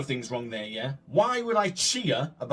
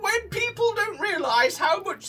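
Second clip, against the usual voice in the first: very high, hammed-up witch; witch's voice; 3 dB louder than usual. Player voicing the witch scolding the boy, hammed-up witch. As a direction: play the storybook witch, pitched right up. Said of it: "I need to do a proper witch's voice".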